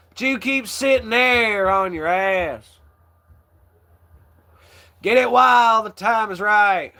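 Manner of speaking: hammed-up Southern comic sermon; Larry the Cable Guy. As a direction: holler it like a good-old-boy comedian lecturing the crowd. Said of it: "and you can hear him say".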